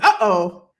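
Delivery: low, loud teasing uh-oh; a playful warning, drawn low; host teasing a confident viewer, low mock warning. Real, not acted. Reacting to "about to win.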